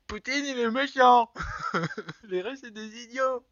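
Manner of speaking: loud, high-pitched clowning; mock shouting. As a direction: blurt out the silly joke, high and loud. Blurts out the teased mocking joke.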